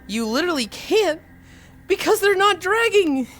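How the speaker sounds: high, rising to loud outcry; exasperated protest. Real, not acted. Streamer denying her feet drag, exasperated.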